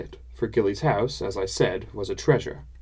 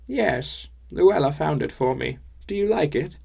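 put-on old woman voice; 5 semitones higher than usual. Old woman Gilly replies.

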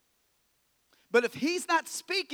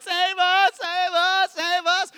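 loud, high panicked wail for help; mock crying baby; 10 semitones higher, 11 dB louder, slower than usual. Scared disciples in the boat calling to Jesus, mock crying shout. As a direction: cry out like big babies in a storm. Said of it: "there's crying baby disciples in the boat".

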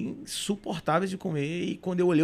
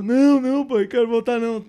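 high, loud imitated whine; hammed-up tearful refusal; mock tearful; 6 semitones higher, 7 dB louder than usual. Crying son refusing his father's offer, mock tearful. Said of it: "comecei a chorar".

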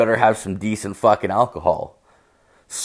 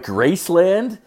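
incredulous; 10 semitones higher, slower than usual. Retold or imitated. Incredulous at [name]'s house.

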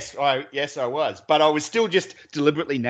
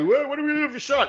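high-pitched mock excited clamour; imitated eager crowd; 10 semitones higher than usual. Mates asking what the first shot is, high excited mimicry. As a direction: pitch it right up, like rowdy drinkers.

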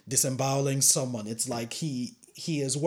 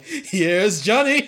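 very slow, high, louder, laughing menace; Jack Nicholson; 7 semitones higher, 8 dB louder, much slower than usual. Retold or imitated. Quoting The Shining door scene as Jack Nicholson. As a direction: drag out the famous line like a madman at the door. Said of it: "Jack Nicholson".

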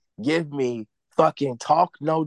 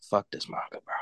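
whispered under breath, disgusted; muttered aside; 9 dB quieter, faster than usual. Real, not acted.

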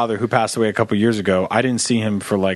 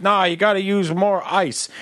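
picky grandpa; 7 semitones higher than usual. Retold or imitated. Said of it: "he'd be like".

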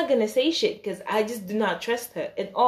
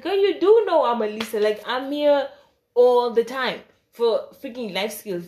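louder and higher, exasperated; exasperated outburst; 4 semitones higher, 6 dB louder than usual. Pretend. To classmates who claim not to know her, exasperated loud outburst. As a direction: let it burst out, fed up. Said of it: "I didn't say that".

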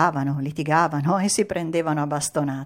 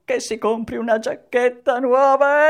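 high, loud, sneeze coming on; about to sneeze; 7 semitones higher, 7 dB louder than usual. A narrator's reading. Mangiafuoco gifting money for a jacket, about to sneeze. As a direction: talk through a building sneeze.

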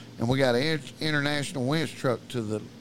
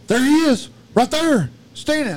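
high, loud cry of sighting; alarmed holler; 12 semitones higher, 9 dB louder than usual. Retold or imitated. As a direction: point it out in mock panic. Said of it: "He's hollering at old Bigfoot".

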